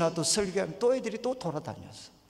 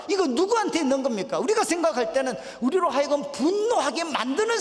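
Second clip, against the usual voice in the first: high, loud, urgent pulpit voice; full-force preaching; 7 semitones higher, 5 dB louder than usual. Acted. Preacher challenging listeners on whose evil it is, full-force preaching. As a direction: hammer the point into the congregation.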